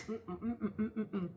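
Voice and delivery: pleased humming while chewing; eating cornbread. Real, not acted. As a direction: mmm through a mouthful. Savoring a bite of cornbread.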